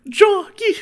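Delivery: put-on squeaky, very high kid yelp; wholesome 1950s kid. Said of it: "What was that even?".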